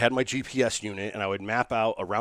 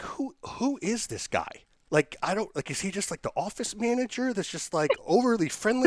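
higher, bewildered wondering; puzzled inner thought; 11 semitones higher than usual. Retold or imitated. His inner thought about the friendly man, higher and bewildered. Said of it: "in my, I'm like".

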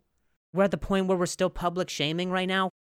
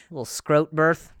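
usual speaking voice

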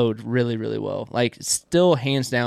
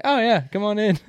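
higher-pitched mock reply; imitated interrupting friend; 9 semitones higher than usual. Friend told he interrupted a podcast, imitated reply.

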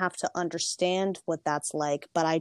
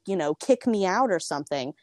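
ordinary talk

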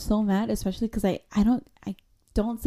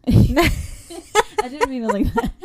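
real laugh through the words, louder and higher; laughing self-correction; 5 semitones higher, 7 dB louder, much slower than usual. Real, not acted. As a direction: backtrack while cracking up. Laughs at her own wording.

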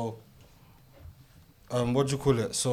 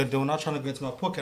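ordinary talk, nothing marked

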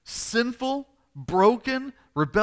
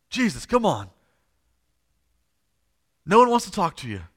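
louder, exasperated urging; frustrated; 4 semitones higher, 6 dB louder than usual. Acted. Father kept waiting while Jesus stops, frustrated urging. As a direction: press impatiently, voice raised. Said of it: "I think he's frustrated".

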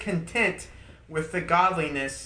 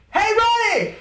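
higher and louder, a booming call of a name; mock loud call; 10 dB louder than usual. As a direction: imitate God yelling across the room. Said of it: "it's never".

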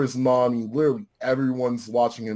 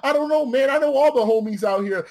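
high, louder, goofy answer; dim-witted reply; 12 semitones higher, 5 dB louder than usual. Retold or imitated. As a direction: answer like a proud simpleton. Strike's dumb answer acted.

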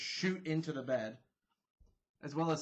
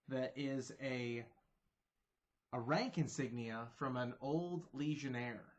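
usual speaking voice

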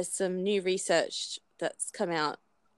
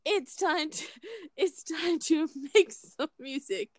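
laughing; 8 semitones higher than usual. Pretend. Announces music through laughter.

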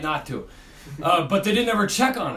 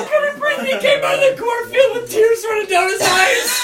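very high squeal of laughing speech; real wheezy, squealing hysterics; helpless laughter; 4 dB louder than usual. Real, not acted. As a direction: force the words out while cracking up. Helpless laughter while telling story.

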